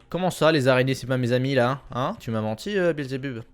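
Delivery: mock indignation. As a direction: protest as if jokingly betrayed. Mock scolding of a game item. (fausse indignation)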